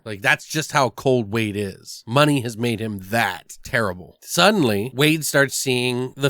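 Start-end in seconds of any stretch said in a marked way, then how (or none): none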